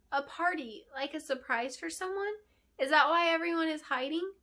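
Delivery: put-on high, eager child's voice; a small apprentice girl, bright and apologetic; little girl voice. Little apprentice surprising the queen, high child voice. Adeline's guesses.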